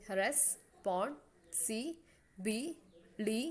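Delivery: "Responsibility" stretched very slowly into six separate beats; syllable by syllable. Shown as a demonstration. Teacher counting the syllables for students, very slowly. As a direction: count out "Responsibility" one beat at a time. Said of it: "has six syllables".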